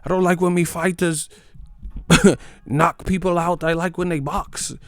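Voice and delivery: high mock British accent, pompous preference; mock British fan. Mocking British fans claiming they prefer boxing, high mock accent. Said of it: "But now they like".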